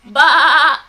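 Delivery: a loud, drawn-out sheep's ba; loud sheep bleat. Shown as a demonstration. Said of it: "Sheep say ba".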